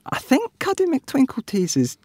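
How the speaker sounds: high silly daddy voice; cheery cartoon dad; Daddy Pig voice. A narrator's reading. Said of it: "said Daddy Pig".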